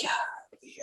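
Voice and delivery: whispered amazement to himself; hushed, marveling inner voice; awed whisper. Retold or imitated. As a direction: whisper it under your breath.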